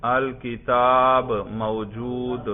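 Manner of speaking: very slow, long vowels drawn out; slow dictation. Real, not acted. Teacher dictates the next sentence for students to write, slowly. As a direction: dictate it word by word, stretching each word. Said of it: "اگلا جملہ لکھیے بھائی".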